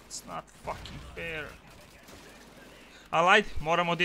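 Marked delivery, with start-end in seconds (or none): 0.1-1.5 much softer, sunk into resignation; resigned mutter. Real, not acted. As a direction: let it fall away, defeated. Repeating the complaint, deflated.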